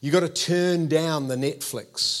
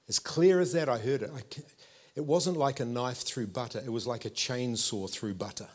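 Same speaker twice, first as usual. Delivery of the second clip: ordinary talk, nothing marked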